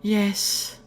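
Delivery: high, drooling yet ladylike sigh; Velvet. A narrator's reading. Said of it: "Velvet said in a drooling yet ladylike sigh".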